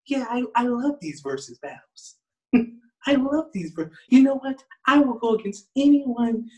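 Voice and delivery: high-pitched, put-on pop star voice. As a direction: play him high and eager to take anyone on. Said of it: "[name]'s the type of dude".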